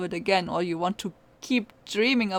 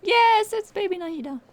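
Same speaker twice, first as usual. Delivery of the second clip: high, loud delighted cry; excited squeal; 12 semitones higher, 6 dB louder than usual. Real, not acted. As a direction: gush over the little one arriving. Excited about Nahida arriving.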